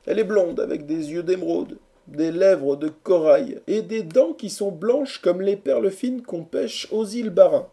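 high, theatrical praise of the beloved; rapturous declaiming. A narrator's reading. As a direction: declaim her portrait like an exalted lover. Lovesick orator praises his beloved. (déclamation amoureuse d'une voix haute)

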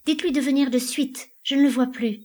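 high-pitched worried plea; anxious urging. A narrator's reading. Elida sends Arnholm for her husband, anxious.

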